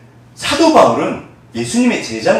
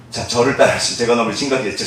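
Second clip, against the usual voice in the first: ordinary talk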